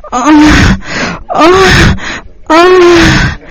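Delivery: high drawn-out cries; repeated high moans; acted moan. A narrator's reading. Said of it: "Otro orgasmo sonoro".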